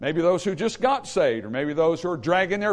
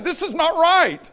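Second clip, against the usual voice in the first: loud, high, slow declaration; emphatic preacher's shout; forceful objection; 11 semitones higher, 7 dB louder, slower than usual. Acted. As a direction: thunder it out like an outraged preacher. Acting Paul's dissent, forcefully.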